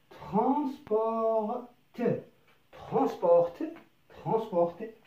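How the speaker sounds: "ترونسبورت" read slowly with a lifted tone; slow, careful model of "ترونسبورت"; slow teaching read. Shown as a demonstration. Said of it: "لنقرأها مع بعض".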